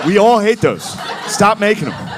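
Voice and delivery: mock exasperated plea from friends. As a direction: beg them to stop, laughing.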